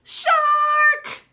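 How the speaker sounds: high, loud cry of fright; frightened cry. Acted. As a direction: scream out the danger. Shark appears in story.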